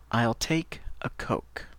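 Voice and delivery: "I'll take a Coke" whispered slowly; whispered model. Shown as a demonstration. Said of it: "Par exemple".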